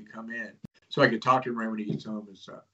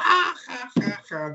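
mocking class laughter; 12 semitones higher than usual. Classmates mocking his Bigfoot talk.

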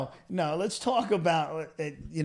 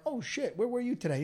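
mock surprise; 6 semitones higher, 6 dB quieter than usual. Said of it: "I'm like".

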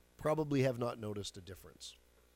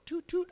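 high playful toot toot; squeaky mock horn sound; 4 dB louder than usual. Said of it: "I'm gonna toot my own horn".

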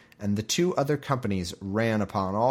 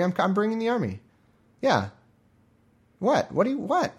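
playing dumb; 6 semitones higher than usual. The figure pretends not to hear about the army, high flustered squeak. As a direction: dodge the question in a panicked squeak.

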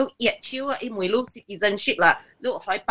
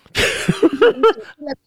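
amused laugh in short bursts; higher-pitched chuckle; 6 semitones higher, 4 dB louder than usual. Real, not acted. Reacts to racist remark.

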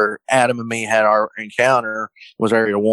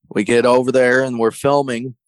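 ordinary talk, nothing marked

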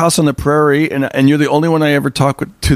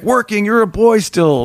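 higher-pitched, disbelieving retort; mock incredulous father; 8 semitones higher than usual. Father to working son, mock incredulous. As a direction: throw the word back, incredulous.

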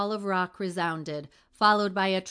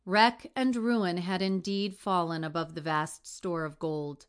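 usual speaking voice

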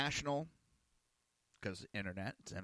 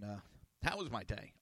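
ordinary delivery

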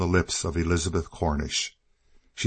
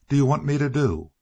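quick, higher-pitched, mildly breathless question; gasped question; 7 semitones higher, faster than usual. A narrator's reading. Shaken woman asks girl what she wants, mildly gasping. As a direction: ask it in a rush, pitch jumping. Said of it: "gasped Elizabeth".

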